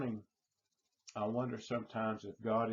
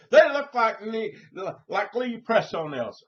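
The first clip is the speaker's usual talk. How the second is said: much higher, much louder screeching griping; imitating the customer's loud shrill complaint; shrieking complaint; 10 semitones higher, 11 dB louder than usual.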